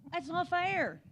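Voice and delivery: put-on kid's complaint. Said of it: "heard the phrase".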